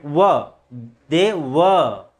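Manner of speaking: "were" as va, r dropped; silent r. Shown as a demonstration. Teacher drilling the word, "were" with silent r. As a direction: isolate "were" ending on an open schwa. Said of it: "आर के जगह पे replace अ".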